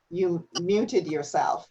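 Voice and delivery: normal talking voice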